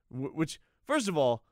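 ordinary talk

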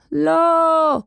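high, drawn-out cry of protest; shout. A narrator's reading. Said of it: "צעק נוח". (צעקת מחאה גבוהה ומתמשכת)

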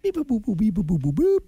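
robotic beeping noises; computer processing. Pretend. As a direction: beep boop like a machine thinking. Random food generator noise.

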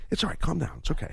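soft hushed calming voice; the wife calming her husband, softly; quiet imitation. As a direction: shush him gently, barely audible. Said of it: "she does the quiet".

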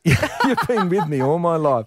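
high, slow, laughing through the fan's line; mock-earnest fan, cracking up; laughing quote. Fan tells the old footballer he's always been there, laughing. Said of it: "He goes".

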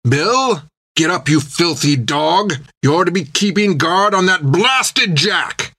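high-pitched captain's shout. A narrator's reading. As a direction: scold a slacking crewman at a shout. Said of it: "shouted the captain".

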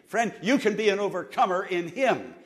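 loud, driving sermon build; preaching. Acted. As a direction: urge them to quote it aloud. Preaching at full force.

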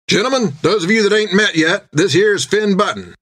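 high, hearty captain's voice; a big captain scolding with a grin; jovial captain. Acted. Jack hollering to Finn down the table, hearty captain.

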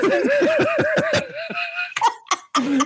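a high, breathy fit of laughter. Real, not acted. Laughing at the joke.

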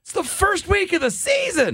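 exasperated high-pitched rant impression; Tony La Russa. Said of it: "I'm gonna channel Tony La Russa".

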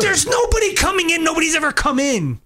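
high-pitched exasperated shout; real frustration, voice shooting up; exasperated outburst. Real, not acted. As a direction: burst out in disbelief, pitch jumping high. Exasperated argument over an imagined intruder.

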